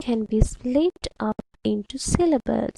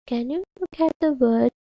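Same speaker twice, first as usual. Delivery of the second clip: normal talking voice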